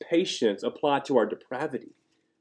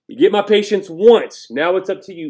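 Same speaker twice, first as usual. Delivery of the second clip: mock ultimatum; 7 semitones higher, 9 dB louder than usual. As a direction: lay down terms, easing at the end. Mock God giving an ultimatum.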